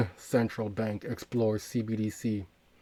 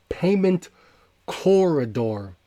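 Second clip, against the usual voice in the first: Payment punched high and loud, then a slow, loud corridor; emphasized phrase; 5 semitones higher, 5 dB louder, slower than usual. Real, not acted. Host stressing the key term to his viewers, emphatic. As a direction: hit Payment hard and drag out corridor. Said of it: "And I emphasize that word".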